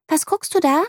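put-on high, curious little girl; girl asking what her friend is staring at, high and curious; curious child. A narrator's reading. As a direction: ask with wide-eyed interest. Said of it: "will sie wissen".

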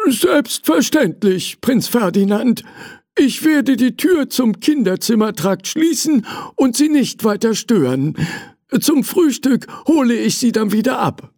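high, eager servant voice; a polite servant answering; old servant. A narrator's reading. Servant Hugo promises the prince, high and polite.

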